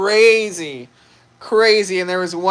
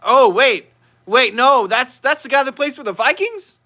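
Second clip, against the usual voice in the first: mock shock, pitched up into a question; dawning realisation; 6 semitones higher than usual. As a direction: act out suddenly putting it together. Retold moment of stunned realisation.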